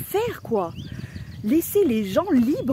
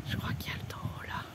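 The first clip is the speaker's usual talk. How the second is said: whisper; 12 dB quieter, faster than usual. Real, not acted. Near the bull, whispering.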